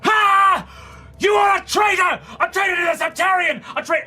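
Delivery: a mage screaming at the traitor; screaming accusation. Acted. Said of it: "and he screams".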